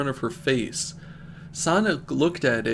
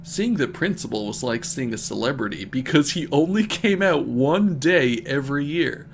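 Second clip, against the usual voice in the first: plain reading voice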